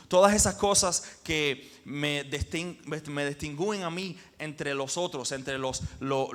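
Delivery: ordinary talk, nothing marked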